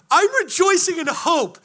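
high, loud exultant proclamation; hammed-up rejoicing. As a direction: shout it with over-the-top joy. Acted answer to puzzled kid.

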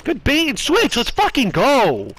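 high, slow hyped cheer. Real, not acted. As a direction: celebrate the play loudly. Celebrating a play.

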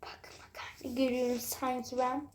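hushed suspicious whisper. Real, not acted. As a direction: whisper it while peeking. Poşeti gizlice kontrol ediyor. (fısıltıyla)

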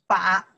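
把 as an isolated third-tone demo. Shown as a demonstration. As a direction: say 把 firmly, dipping then rising. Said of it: "third tone".

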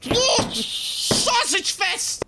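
drawn-out high slow cry; frustrated wail. Real, not acted. Gamer cursing the game, frustrated wail. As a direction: stretch it out in frustration.

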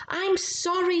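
a touch of nervous spluttering; spluttered apology. A narrator's reading. Dragon backs away from the monster, spluttering nervously. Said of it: "he spluttered".

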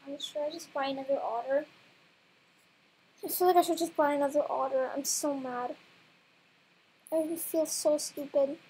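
ordinary talk, nothing marked

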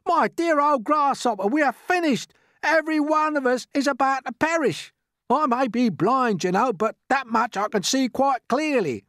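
high, loud cries of despair; doom-laden earthworm. Acted. Earthworm warns grasshopper they will all perish, despairing. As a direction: wail that all is lost.

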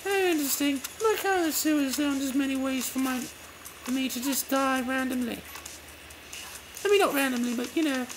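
high-pitched game heroine, chatty; lively high character voice; Faith voice. Acted. Returns to Faith voice.

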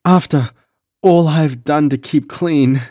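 high, tearful, broken-up lament; anguished words mingling with sobs; sobbing. A narrator's reading. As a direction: lament through acted tears, pitched well up. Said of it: "she sobbed".

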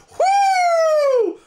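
high screaming woo; Ric Flair woo. Retold or imitated. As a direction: let out a wrestler's woo. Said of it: "Can we get a Ric Flair".

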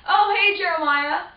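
high, loud bright hello; cheery greeting. Retold or imitated. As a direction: call out happily to a friend. Brother greets friend cheerfully.

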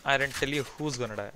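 normal talking voice

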